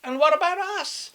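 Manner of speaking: high, loud penguin asking; high penguin complaint. Acted. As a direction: pipe up from the back as a penguin. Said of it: "said a voice".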